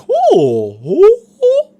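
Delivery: loud, swooping mock "ooh"; big swooping oohs of a gawking crowd; mock tourists' oohing. Comic imitating tourists gawking at him, loud mock oohing.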